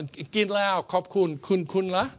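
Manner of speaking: bright Thai answer, "Gin lao, kop kun"; Thai reply. Shown as a demonstration. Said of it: "your exchange will go".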